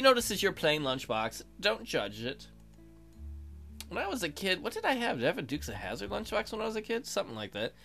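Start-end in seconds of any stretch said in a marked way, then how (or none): none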